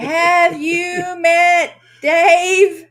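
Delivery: very slow, loud, each word stretched; slow-motion "Have you met Dave?", pushed loud; drawn-out emphatic question. Acted. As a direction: stretch out every word for comic effect. Comic repeat of the same question.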